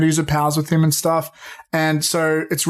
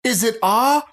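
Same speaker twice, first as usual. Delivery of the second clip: higher-pitched mock call-out; imitated crowd yell; 7 semitones higher than usual. Fan in the crowd calling out to the panel, mock yell. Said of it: "this one guy in the crowd yells out".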